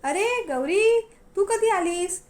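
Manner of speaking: stretched, sugary fake welcome; fake cheerful greeting. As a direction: greet her with a false smile, slowly. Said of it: "नकली smile आणलं".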